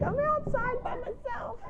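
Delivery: high, slow, pitiful put-on goat voice; whiny goat voice. Voicing the goat crying alone outside, high whiny put-on voice. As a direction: whimper it like the lonely goat. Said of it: "He's out here crying like".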